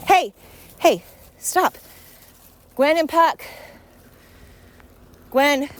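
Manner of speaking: high-pitched scolding call to the dogs. Real, not acted. Owner telling Gwen and Puck to stop, high-pitched calling out.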